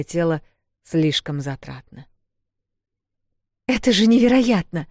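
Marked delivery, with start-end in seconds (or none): 3.7-4.9 higher and louder, thrilled; amazed exclamation. Acted. Эл exclaiming to Вэл in amazement. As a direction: burst out in wonder. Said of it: "воскликнула Эл".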